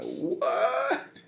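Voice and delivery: long drawn-out, rising disbelief; comic shock. Retold or imitated. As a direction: stretch it out, hammed-up astonishment. Stunned reaction to car rocking.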